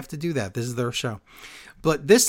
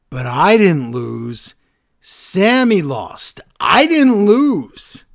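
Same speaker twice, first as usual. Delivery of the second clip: slow, building, louder insistence; mock angry Reigns; 5 semitones higher, 8 dB louder, much slower than usual. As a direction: hammer each word slower and louder. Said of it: "He says".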